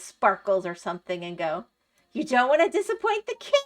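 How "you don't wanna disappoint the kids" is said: high, loud mock plea; teasing friend. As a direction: imitate a friend sweetly pushing you, pitched way up. Friend teasingly pressures her with hat.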